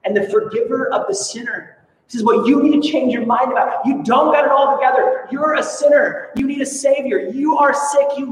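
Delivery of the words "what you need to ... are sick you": louder and higher, a preacher voicing Jesus' firm call to repent; bold preaching rebuke. Preacher voicing Jesus telling people they need a savior, loud firm rebuke. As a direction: proclaim it like Jesus calling out sinners.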